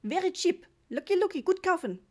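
high, loud bursts of sales patter; excited market trader pushing a bargain; enthusiastic vendor. A narrator's reading. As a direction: hawk it eagerly in short calls. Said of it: "rief er begeistert".

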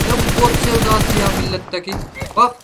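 loud exasperated mockery; mocking complaint. Real, not acted. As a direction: scoff at him loudly. Mocking enemy's love of bots.

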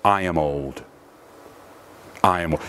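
put-on low, emphatic "I am old". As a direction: state "I am old" as a low, firm fact. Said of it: "it is very emphatic".

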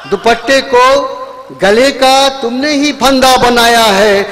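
slow, measured verse with falling close; lingering couplet delivery; poetry recitation. Acted. Poet recites ghazal couplets.

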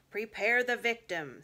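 slow, loud, grim order; cold command. Acted. Witch ordering the dwarf to ready Edmund, slow grim command. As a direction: give the order with chilling slowness.

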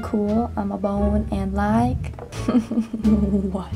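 ordinary talk

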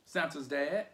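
ordinary delivery

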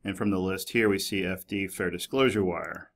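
ordinary delivery

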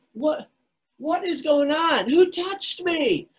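high, drawn-out searching call; a man demanding to know who touched him; alarmed cry. Acted. Jesus acted, alarmed in crowd.

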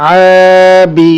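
slow, level, sustained first vowel in "আবি"; stretched reading. Shown as a demonstration. Teacher reading through for students, "আ" drawn out. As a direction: hold "আ" long in "আবি", then short "বি". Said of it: "টানা পড়ে চলে যাবো".

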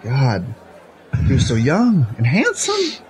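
slow, drawn-out pretend lament; mock mourning. Guest imagining host hearing of his death, mock mourning. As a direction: grieve theatrically, stretching out the praise. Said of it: "when you get the news".

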